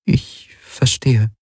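slow, whispered resignation; painfully hard-won words. A narrator's reading. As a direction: force it out slowly in a near whisper. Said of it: "Nichts war ihr je so schwer gefallen". (gequält, langsam geflüstert)

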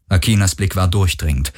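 ordinary delivery

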